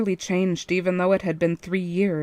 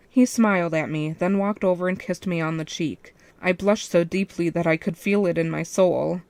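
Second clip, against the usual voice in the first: plain reading voice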